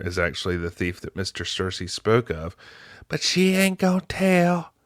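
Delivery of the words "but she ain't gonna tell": mock backwoods girl accent; put-on country accent. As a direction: imitate a thick country accent, pitched way up. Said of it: "That accent, man".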